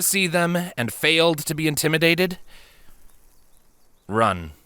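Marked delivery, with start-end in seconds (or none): none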